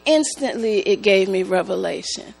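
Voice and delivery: ordinary delivery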